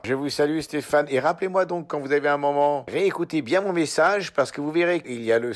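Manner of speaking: mock cheerful. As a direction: pump up the cheer, a bit overdone. Loud cheerful voicemail sign-off. (au revoir enjoué, plus aigu et plus fort)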